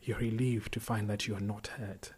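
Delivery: ordinary delivery, nothing marked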